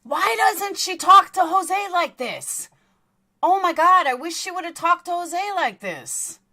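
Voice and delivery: high, loud, incredulous cry of disbelief; exasperated outburst. Real, not acted. Host venting to listeners about the panel, exasperated. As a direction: throw your hands up in exasperation.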